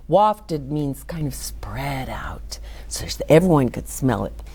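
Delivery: quick low whisper to the listeners; whispered aside. Real, not acted. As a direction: drop to a whisper to explain the word. Reader explains a word aside.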